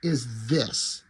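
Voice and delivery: slow, drawn-out what in the hell; dragging out each word in baffled disbelief; stunned disbelief. Retold or imitated. Reviewer recalling his shock at the comic, slow and stunned. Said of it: "But I'm like".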